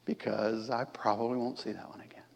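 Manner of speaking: unvoiced resigned whisper; a wry whispered aside to the congregation. Real, not acted. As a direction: lean in and whisper it. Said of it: "whispered aside".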